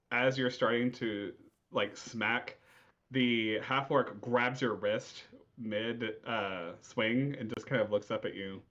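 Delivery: usual speaking voice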